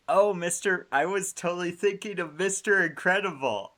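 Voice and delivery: oh no, I mixed them up; excited. Real, not acted. Excited realization of mistake.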